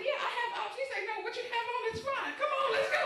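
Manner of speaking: high, hurried wardrobe guy; mock wardrobe guy.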